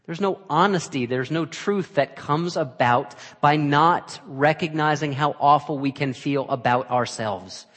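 loud, slow, ringing sermon voice; full-force preaching. Acted. Preacher pressing truth on the congregation, loud and slow. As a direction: drive it home from the pulpit.

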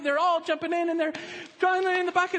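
normal talking voice

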